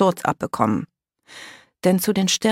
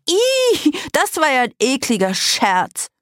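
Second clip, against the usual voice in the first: high squeal, then indignant nasal protest; grossed-out girl complaining; disgusted complaint; 10 semitones higher, 4 dB louder than usual. A narrator's reading. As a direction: whine it in revulsion. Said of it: "beschwerte sich Greta".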